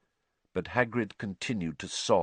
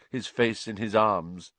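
ordinary delivery

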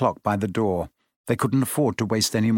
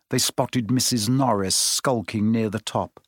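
ordinary delivery

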